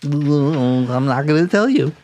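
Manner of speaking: slow, teasing refusal; lightly voiced coy architect; coy [name]. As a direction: tease them, draw it out.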